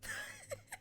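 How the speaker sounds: high, far peal of hearty laughter. A narrator's reading. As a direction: burst into a high guffaw. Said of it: "let out a peal of laughter".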